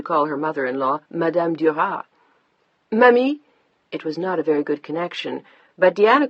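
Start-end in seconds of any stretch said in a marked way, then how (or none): 2.9-3.4 calling louder down a bad line; raised voice. A narrator's reading. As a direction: raise the voice to be heard over static. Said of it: "raised her own voice to make herself heard".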